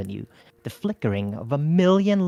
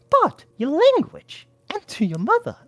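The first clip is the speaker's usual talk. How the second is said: scolding mother; 4 dB louder than usual. A narrator's reading. Mother rebuking son's swearing, shocked. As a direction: scold him like a proper old mother.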